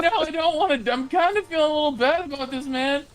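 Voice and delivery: half-laughing, backing away from it; mock reluctant self. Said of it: "I was like".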